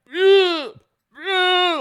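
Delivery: high, loud strained grunts of pulling; straining grunts. Retold or imitated. Brother yanking the dart from his chest, grunting high and loud. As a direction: grunt hard, yanking at something stuck. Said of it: "trying to get out of his chest".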